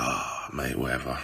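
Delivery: giving up as the pandemic hits; deflated shrug. Retold or imitated. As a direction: let it drop away, defeated. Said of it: "and it's like".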